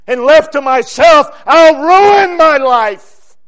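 loud, high, drawn-out preaching shout; full-force preaching. Real, not acted. Preacher confessing his own helplessness, full-force preaching. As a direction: build into a long, forceful cry.

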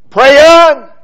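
a loud, high one-word shout; shouted command. Acted. Preacher urging listeners in battle to pray, shouted. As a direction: bark the word at the congregation.

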